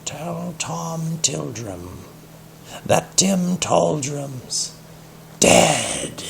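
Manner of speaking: slow, high, hushed ghostly cat voice; eerie cat voice. Acted. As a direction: stretch every word like a spooky cat. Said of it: "and spoke".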